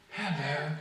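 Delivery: small, soft voice, comic letdown; softly deflate the villain into a meek greeting; little unmasked voice. Acted. Villain with helmet unscrewed greets meekly, small soft voice. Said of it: "when you take the megaphone off".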